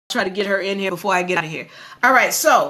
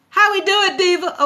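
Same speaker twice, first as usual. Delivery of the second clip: excited call; 8 semitones higher, 3 dB louder than usual. Real, not acted. Calling out to the audience.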